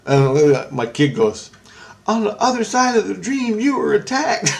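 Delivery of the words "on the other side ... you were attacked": put-on high-pitched cute child voice; imitating his kid. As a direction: parrot it in a small high voice. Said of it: "it sounds so cute".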